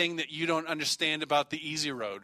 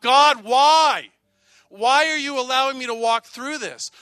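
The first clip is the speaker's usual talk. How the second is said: loud, high, anguished cry of why; pleading scream at God; 8 semitones higher, 10 dB louder than usual. Pretend. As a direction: cry out to heaven in desperate protest. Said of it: "you're gonna scream out".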